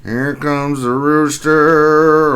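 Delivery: very slow, drawn-out "Here comes the rooster"; announcer intro. Acted. Streamer introducing viewer Rooster as a fighter, drawn-out call. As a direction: announce him like a fighter entering.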